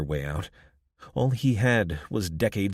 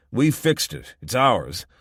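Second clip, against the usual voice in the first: petulant; 4 semitones higher, 6 dB louder, slower than usual. A narrator's reading. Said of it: "Mitchell said petulantly".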